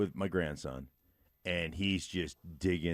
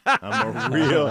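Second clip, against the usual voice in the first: laughing; 8 semitones higher, 9 dB louder, much slower than usual. Retold or imitated.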